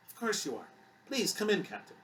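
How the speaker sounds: put-on high, lilting, accented welcome; musical accented voice. A narrator's reading. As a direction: sing the welcome lightly, with an accent. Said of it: "Susan said in a musical voice with an accent".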